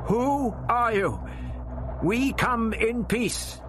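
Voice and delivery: lightly voiced old man, high and slow; mustered defiance, snapping back; the doctor answering the alien voice, defiant; defiant snap. A narrator's reading. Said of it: "snapped back".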